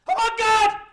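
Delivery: very high, loud gasp of wonder; thrilled by a new morning; amazed shout. Pretend. Waking up amazed.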